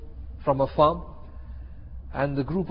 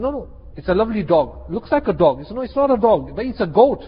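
high, emphatic back-and-forth contradiction; mock heated squabble; acted insistent dispute; 5 semitones higher, 5 dB louder, faster than usual. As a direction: argue both sides, raising pitch and volume. Acted dispute over dog or goat.

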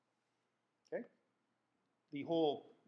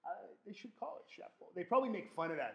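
ordinary delivery

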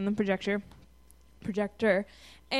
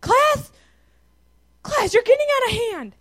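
high, loud, hammed-up scolding; freaking-out angry teacher; 9 semitones higher, 7 dB louder than usual. Student mimicking a teacher scolding a giggling class, hammed-up fury. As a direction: imitate an exasperated teacher losing control.

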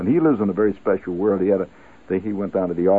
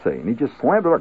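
usual speaking voice

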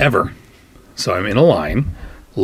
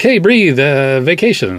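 higher and louder coaxing call; urgent prompt; 6 semitones higher, 4 dB louder than usual. Retold or imitated. Staff to the woozy patient, urgent and loud. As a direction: snap him out of it with a call. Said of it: "So they're like".

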